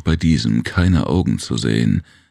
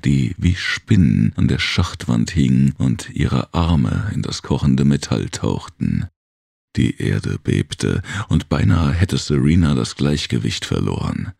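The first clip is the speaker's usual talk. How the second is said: plain reading voice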